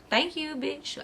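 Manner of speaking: soft, high-pitched mock gratitude; cheeky thanks. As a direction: act out a cheeky thank-you, high and quiet. Acts out accepting the drinks.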